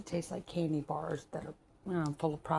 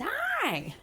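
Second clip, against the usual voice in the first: sudden high greeting. Real, not acted. Greeting a baby mid-sentence, sudden high hi.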